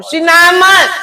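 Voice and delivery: high, loud retort; exasperated snap. Retold or imitated. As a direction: re-enact snapping at the operator. Retold snapping at operator.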